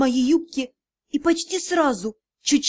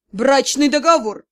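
angry hiss; 5 dB louder than usual. A narrator's reading. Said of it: "прошипел магистр".

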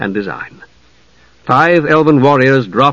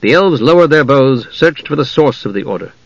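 plain reading voice

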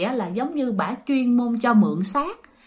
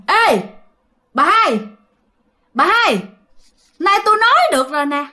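high, loud ghostly hail; high, drawn-up calls to the grandmother; possessed medium; 7 semitones higher, 4 dB louder than usual. Acted. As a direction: call out sharply as the spirit speaking through a medium.

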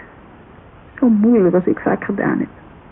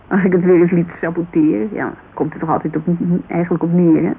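ordinary talk

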